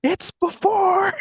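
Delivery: very high, drawn-out holler; slow, loud, high-pitched shout; imitated yelling. Russell yelling back to Carl, high drawn-out imitated yell. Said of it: "he's, like, yelling to Carl".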